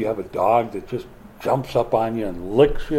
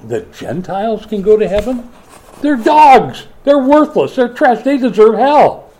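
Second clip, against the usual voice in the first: higher and louder, spitting contempt at the Gentiles; mock scornful outrage; 9 semitones higher, 6 dB louder than usual. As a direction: rant like an indignant zealot looking down on outsiders.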